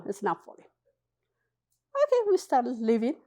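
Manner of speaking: normal talking voice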